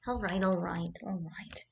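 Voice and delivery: soft, breath-only reassurance; soothing whisper. Real, not acted. Hushing her baby.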